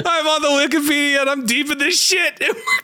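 very high, hyped-up mock shriek; manic screaming. Streamer hyping lore to baffled co-host, manic squeal. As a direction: squeal it with over-the-top hype. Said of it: "I'm sorry for screaming into the mic".

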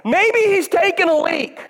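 high, slow mock taunt. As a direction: savour the jab. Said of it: "starts taunting them".

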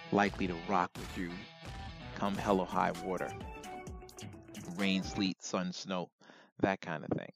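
ordinary talk, nothing marked

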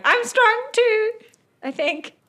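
very high, loud protest; puffed-up little boast. Acted. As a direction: puff your chest out and squeak it proudly. Oriole puffs up her chest, boasting.

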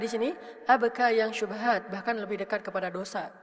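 ordinary delivery

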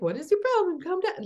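hammed-up high nagging parent; mock stressed parent. Mocking parent snapping at kids.

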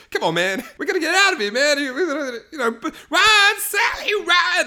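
high, hammed-up near-crying panic; loud mock-hysterical pleading; freaking out. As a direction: beg in a comic meltdown. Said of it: "It's him freaking out".

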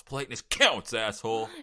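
"counts" punched high and loud; stress on "counts". Retold or imitated. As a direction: imitate the surfer, hitting "counts" hard. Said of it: "he does a nice, like, punch on counts".

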